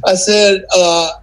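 usual speaking voice

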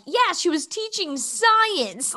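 high, drawn-out awe, imitating a friend; amazed friend. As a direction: marvel on a friend's behalf, slow and high. Retelling friend's amazed account.

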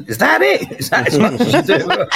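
hammed-up high taunt with a laugh at the end; mocking Scottish players. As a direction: jeer at the lazy-looking training like a scornful Scot. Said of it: "the Scottish players would say".

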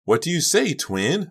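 high, perky coaxing question; bubbly, pitched well up; eager urging. A narrator's reading. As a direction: press brightly for an answer. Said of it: "Honey urged".

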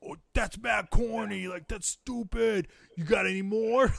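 put-on high, teasing kid voice; mocking kids. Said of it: "their reaction is always like".

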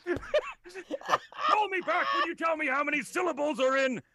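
loud, high, slow put-on shouting ending in a cry; over-the-top rant; hammed-up yell. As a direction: dismiss him with a theatrical scream. Streamer mock-kicking out viewer.

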